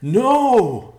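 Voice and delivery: loud anguished scream. A narrator's reading. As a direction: scream in despair. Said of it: "Ben screamed".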